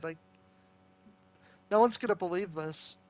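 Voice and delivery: ordinary talk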